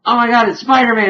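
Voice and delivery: high, loud, fast fanboy outburst; mock freak-out. Ghost dog spots Spider-Man, mock freak-out. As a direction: imitate someone losing it with excitement. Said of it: "they were freaking out over each other".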